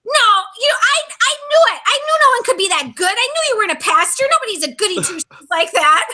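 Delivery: high, fast, triumphant I-knew-it; gloating Brett. Retold or imitated. Brett gloating to her about the pastor lie, high and smug. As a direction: crow like you saw through her all along. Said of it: "And so Brett's like".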